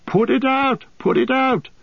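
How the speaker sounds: very high, strained plea; desperate begging. A narrator's reading. Merry trapped in the willow, begging desperately. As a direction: beg in panic, voice pitched right up. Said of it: "begged Merry".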